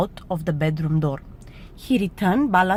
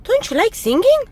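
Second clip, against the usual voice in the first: high, loud little mouse voice; puzzled mouse; 7 dB louder than usual. A narrator's reading. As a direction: ask innocently as a mouse. Mouse asks grumpy Bear.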